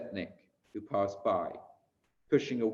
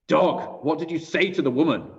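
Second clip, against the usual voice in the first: loud, higher-pitched harsh challenge; angry demand; 5 semitones higher, 6 dB louder than usual. A narrator's reading. Young German guard confronts a prisoner, loud angry demand. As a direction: demand an answer like a threatening guard. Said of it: "demanded an answer".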